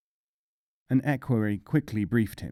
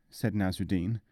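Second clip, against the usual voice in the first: plain reading voice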